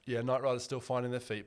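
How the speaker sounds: usual speaking voice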